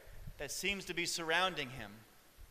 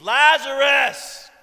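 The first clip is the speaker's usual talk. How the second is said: slow, much higher, full-voiced call; commanding shout; 9 semitones higher, 17 dB louder, slower than usual. Jesus to dead Lazarus, a booming command. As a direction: call him out of the grave.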